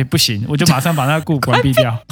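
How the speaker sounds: ordinary delivery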